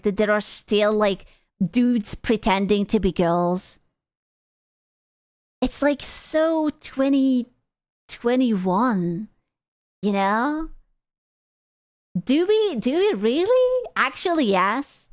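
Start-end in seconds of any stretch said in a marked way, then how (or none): none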